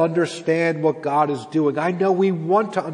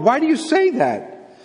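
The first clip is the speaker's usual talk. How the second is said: high-pitched, forceful questioning; exasperated challenge; 10 semitones higher, 3 dB louder than usual. Acted. Preacher voicing God challenging the exiles, exasperated.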